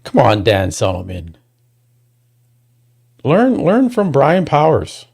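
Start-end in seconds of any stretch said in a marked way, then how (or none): 3.2-5.1 slow, higher, every word pressed; scolding the organiser, slowly; emphatic lecture. Real, not acted. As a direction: spell the lesson out to him.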